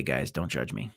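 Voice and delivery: ordinary talk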